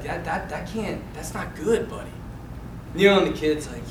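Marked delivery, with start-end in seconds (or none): 0.0-2.1 hushed, halting retold reproof; gentle dad. Dad chiding his buddy, softly imitated.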